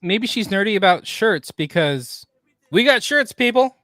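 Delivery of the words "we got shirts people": higher, louder sales call; hyped catchphrase. Pretend. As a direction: call it out to the crowd. Calling out a merch catchphrase.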